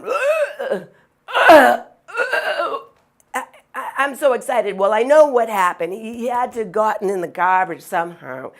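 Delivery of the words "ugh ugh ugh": high strained choking gasps, loud at the end; imitated dog choking. Owner mimics her choking dog with high strained gasps. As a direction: act out the dog hacking on a bone. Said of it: "He's going".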